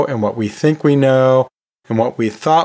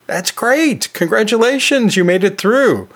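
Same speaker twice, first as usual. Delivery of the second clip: bright, high burst of congratulations; excited congratulations; 7 semitones higher than usual. Acted. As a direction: cheer them on for making it. Congratulating surviving listeners.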